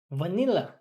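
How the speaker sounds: normal talking voice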